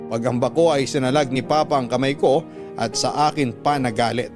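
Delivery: normal talking voice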